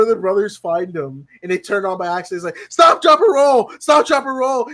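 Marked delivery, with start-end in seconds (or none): 2.7-4.7 loud, high, urgent yell; hammed-up alarm, each word punched; acted panicked shouting. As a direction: shout it like a frantic emergency order. Brother yells at burning man.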